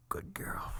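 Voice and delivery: soft breathy whisper of approval; hushed lecherous aside; whispered pleasure. Acted. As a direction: drop to a mock-lustful whisper. Acted pleasure between fake sobs.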